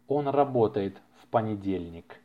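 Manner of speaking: normal talking voice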